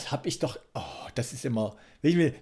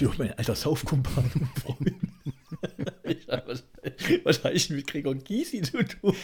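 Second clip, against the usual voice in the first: laughing protest. Real, not acted. Laughing at the teasing.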